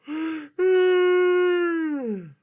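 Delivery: a big, high, stretched-out cartoon yawn; huge yawn. Puppet yawns hugely in front of the kids, high and loud. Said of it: "now that is a mighty big yawn".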